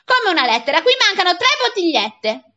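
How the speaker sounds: high, loud incredulous retort; acted angry interrogation; outraged shouting. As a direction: challenge the boy's excuse furiously. Angry man interrogates the boy.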